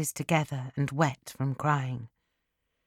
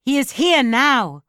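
raised, higher narrated shout; shouted warning; 6 semitones higher, 5 dB louder than usual. A narrator's reading. Servant warning the lovers that the husband has arrived, shouted. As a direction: call out an urgent warning, higher and louder. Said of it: "I shouted".

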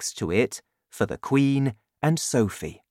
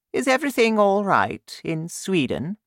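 high-pitched regal voice; inquiring Queen; 8 semitones higher than usual. A narrator's reading. Queen phoning the King of Sweden, high and concerned.

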